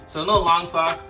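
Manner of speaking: loud hype. Acted. Hype transition.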